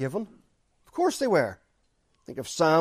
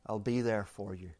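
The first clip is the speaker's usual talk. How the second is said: tender voice of God; 6 semitones lower, 9 dB quieter than usual. Acted. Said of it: "And He says".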